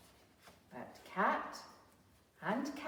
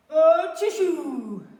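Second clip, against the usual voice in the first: slow, loud mock sneeze into the ear; loud fake sneeze; 8 semitones higher, 14 dB louder, slower than usual. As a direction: blast a sneeze right down his ear. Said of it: "Cow went up to Dog and went".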